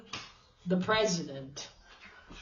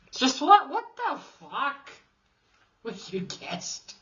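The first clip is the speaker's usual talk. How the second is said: exasperated; 5 semitones higher than usual. Real, not acted. Mocking the character's lucky guess, exasperated. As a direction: sputter at the absurd plot.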